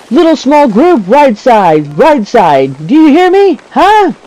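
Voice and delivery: high, urgent mock callout; role-playing squad spotter. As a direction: call positions out over the gunfire. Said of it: "So if I'm role-playing".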